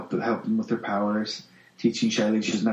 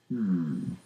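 ordinary delivery

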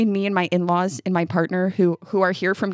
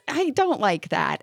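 ordinary talk

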